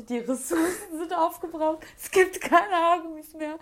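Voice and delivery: hammed-up despair, slowly proclaimed as a catastrophe; mock panic. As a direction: overdramatic retold panic. Acting out panic over missing hair ties.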